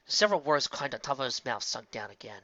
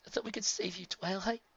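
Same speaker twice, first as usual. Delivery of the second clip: high but softer broken voice; 8 semitones higher, 6 dB quieter than usual. A narrator's reading. Spike heartbroken speaking to Twilight. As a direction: let the voice fall away.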